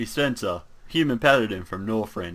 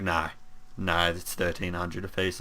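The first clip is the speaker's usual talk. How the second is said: softer, lower, matter-of-fact refusal; unmoved salesman; 5 semitones lower, 7 dB quieter than usual. Acted. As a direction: quietly hold firm on the price. Salesman holds firm on price.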